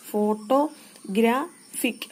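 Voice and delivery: "Photographic" slowly, broken into its syllables. Shown as a demonstration. Teacher showing how syllables divide, "photographic" said slowly in separated syllables. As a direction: say "photographic" in slow, separate chunks with short gaps. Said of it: "syllable എങ്ങനെ divide ചെയ്യുന്നത്".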